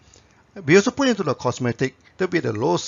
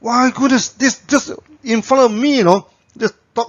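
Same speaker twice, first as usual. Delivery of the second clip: higher and louder, amazed; amazed exclamation; 7 semitones higher, 5 dB louder than usual. Real, not acted. Speaker tells audience of the shop lecturer's claim, amazed disbelief.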